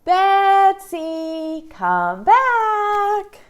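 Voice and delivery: loud, high call to a puppet; a loud singsong call. Shown as a demonstration. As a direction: call Betsy back loudly.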